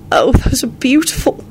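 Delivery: high, grateful exclamation; touched and grateful, pitched high. A narrator's reading. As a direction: thank him with delight in the voice. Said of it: "she said gratefully".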